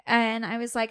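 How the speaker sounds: ordinary delivery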